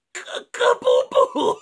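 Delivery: high, slow squeak of Cacapupù!; squeaky cacapupù. Acted. Wolf answering the doctor in the bunny's squeak. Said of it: "E il lupo rispose".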